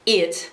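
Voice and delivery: "It" as a two-syllable ee-it for eight; "It" said as Northern Irish eight. Retold or imitated. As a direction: stretch "It" into ee-yit, Northern Irish style. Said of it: "also Northern Irish".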